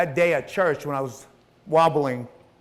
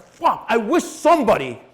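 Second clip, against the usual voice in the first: loud, high, exasperated outburst; angry; 6 semitones higher, 6 dB louder than usual. Retold or imitated. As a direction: re-enact venting in frustration. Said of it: "I was so angry leaving there".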